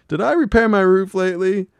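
high, loud whiny Bill; Bill's plea. Retold or imitated. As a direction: whine it helplessly. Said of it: "goes to Hank".

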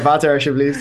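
"Water" as "vater", then alsjeblieft, laughing; playful Dutch-accented order through a laugh; mock Dutch accent. Joking about ordering from Dutch bar staff, mock Dutch accent. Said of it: "to speak a little bit of Dutch too".

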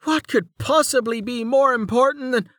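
high, exasperated, cut off; indignant protest. A narrator's reading. Servant arguing with the woman over what matters, indignant protest. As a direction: protest in a high voice until interrupted.

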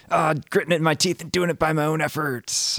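gritted-teeth striving, acted out; mock straining effort. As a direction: push it out as if straining. Acting out striving by willpower.

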